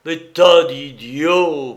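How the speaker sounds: loud, drawn-out furious insult; outraged cry. A narrator's reading. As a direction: hurl it out slowly and loudly. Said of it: "sa fureur redoubla".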